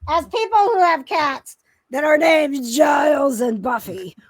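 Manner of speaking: excited growl, voice pushed high and loud; growly mutant voice. Real, not acted. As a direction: growl it out with glee. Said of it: "You got your mutant enemy voice".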